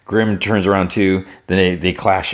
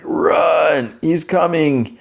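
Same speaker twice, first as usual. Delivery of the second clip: calling out; 10 semitones higher, much slower than usual. Retold or imitated. Hobbling man warns others.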